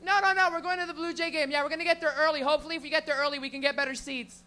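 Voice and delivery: high, loud, chirpy phone chatter; clueless loud lady. Retold or imitated. Lady on streetcar talking loudly on her phone, chirpy and high. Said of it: "She goes".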